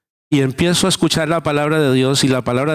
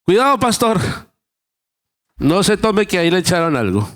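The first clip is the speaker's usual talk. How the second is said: alarmed warning; 5 semitones higher than usual. Retold or imitated. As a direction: warn the pastor urgently, half-acted. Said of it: "ustedes ¿qué dicen?".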